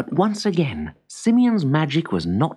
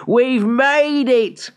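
high, slow, drawn-out cheer; triumphant cry; 10 semitones higher, 4 dB louder, much slower than usual. A narrator's reading. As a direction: stretch it out jubilantly. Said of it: "cried the old woodcutter".

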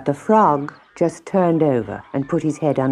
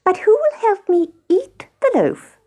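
very high little hen voice; teasing hen. A narrator's reading. Hen asking lazy housemates to share loaf, high and teasing. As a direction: ask high and pointedly. Hen poses pointed final question.

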